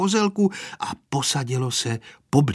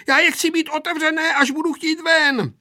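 high, hurried, raised-voice protest; protesting boy; 14 semitones higher, 3 dB louder than usual. A narrator's reading. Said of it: "protestoval Pepíček". (vysoký, naléhavý protest)

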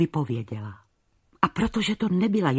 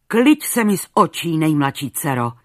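thundering king; 6 dB louder than usual. Acted. King banishing his youngest daughter, thundering in fury. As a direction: roar her out of his sight. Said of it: "zaburácel".

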